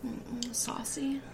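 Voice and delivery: slow breathy whisper; sultry whisper. Pretend. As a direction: purr it out slowly. Names her drink, unvoiced.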